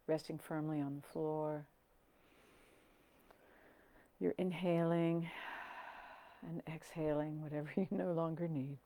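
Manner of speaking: soft, unhurried meditation voice with long pauses; hushed, settling calm; guided meditation. Acted. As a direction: guide them gently down into the body.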